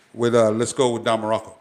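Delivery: usual speaking voice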